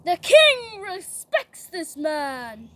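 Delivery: loud, slow, drawn-out announcement; public proclamation. Acted. Prince leading the honored man, proclaiming loudly. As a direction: proclaim it through the city. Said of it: "lead him through the city saying".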